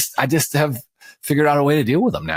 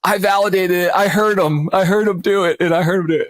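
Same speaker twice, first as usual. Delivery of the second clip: excited son; 8 semitones higher than usual. Retold or imitated. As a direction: insist you heard it, gleeful and high. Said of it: "then Tanner goes".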